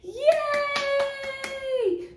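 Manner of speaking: high cheer; excited cheer. Acted. Cheering for kids.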